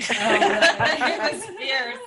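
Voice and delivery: host laughing at her own craving. Real, not acted. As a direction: laugh through the words.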